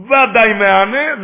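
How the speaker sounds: slow, high, loud proclamation; drawn-out preacher's emphasis; forceful declamation. Real, not acted. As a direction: hammer the quote out slowly. Repeats the quoted ruling with force.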